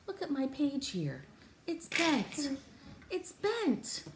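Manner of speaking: ordinary talk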